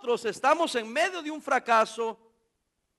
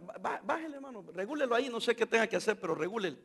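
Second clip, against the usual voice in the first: mock hushed urging; 7 dB quieter, faster than usual. People urging him to calm down.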